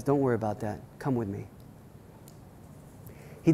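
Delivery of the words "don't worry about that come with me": reassuring elder. Retold or imitated. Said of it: "the head elder looked at her and says".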